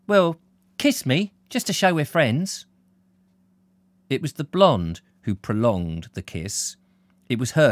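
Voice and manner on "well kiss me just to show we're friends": put-on young woman voice. Blonde coaxes slowly.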